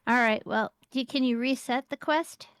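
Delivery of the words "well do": ordinary talk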